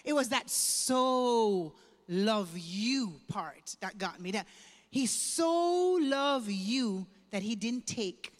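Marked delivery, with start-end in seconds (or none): 0.5-3.2 "so love you" drawn out very slowly; stretched so love you. Retold or imitated. Recalling the preacher's words that touched her, slowly stretched. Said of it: "it's that word". 4.9-7.1 "so loved you" drawn out slowly; slow and deliberate. Retold or imitated. Testifying to the church what moved her, slow and deliberate. Dwelling on the key words.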